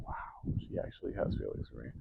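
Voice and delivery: hushed, low, almost whispered amazement; stunned disbelief. Retold or imitated. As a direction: murmur it as if dumbstruck. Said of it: "so shocked".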